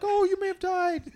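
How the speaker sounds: very high, loud, overdone worry; mock concern. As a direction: gush with exaggerated concern. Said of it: "she was all concerned".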